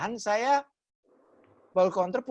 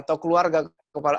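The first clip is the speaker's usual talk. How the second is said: ordinary talk, nothing marked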